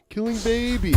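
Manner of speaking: mocking. Mocking the debate clip.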